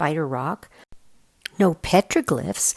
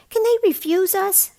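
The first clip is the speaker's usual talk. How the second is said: put-on much higher, louder boy voice; worried kid asking; young boy voice; 7 dB louder than usual. A narrator's reading. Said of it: "sitting up with a jaw-cracking yawn".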